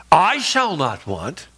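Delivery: slow line with "I" punched and held; stress on "I". Shown as a demonstration. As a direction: hit the pronoun "I" hard and long. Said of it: "Ron emphasized the personal pronouns".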